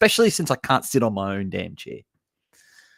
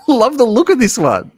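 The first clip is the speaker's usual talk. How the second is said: much higher and louder burst of delight; excited shout; 12 semitones higher, 11 dB louder than usual. Real, not acted. Streamer shouting praise for a trailer to chat. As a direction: blurt it out, thrilled.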